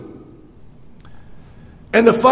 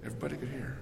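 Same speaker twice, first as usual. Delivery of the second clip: much softer, almost to a whisper; hushed echo; 4 semitones lower, 20 dB quieter, much faster than usual. Shown as a demonstration. Preacher echoes his point to the congregation, hushed. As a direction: let the repeat fall to a hush. Repeats line then drops voice.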